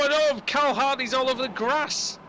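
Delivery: high-pitched dismayed commentary; alarmed live commentator; excited race call. Real, not acted. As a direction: exclaim as the car flies off. Car spins off in race.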